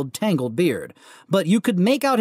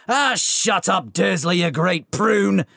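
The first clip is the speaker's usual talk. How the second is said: slow, gruff brush-off; dismissive giant; slower than usual. Acted. Giant dismissing Vernon, slow and scornful. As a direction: wave the little man away. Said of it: "said the giant".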